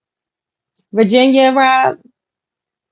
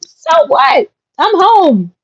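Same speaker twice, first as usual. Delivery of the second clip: much higher, defiant mock retort; defiant comeback; 12 semitones higher, 5 dB louder, slower than usual. Host quotes herself answering her sister, high defiant retort. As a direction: toss it back at her, unbothered. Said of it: "I'm like".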